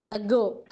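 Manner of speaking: "ago" with the weight on GO; light A, firm GO in "ago"; stress on GO. Shown as a demonstration. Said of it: "we give stress to go".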